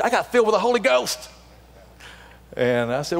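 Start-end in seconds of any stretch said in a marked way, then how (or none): 0.0-1.3 joyful testimony. Retold or imitated. Woman's joyful testimony retold.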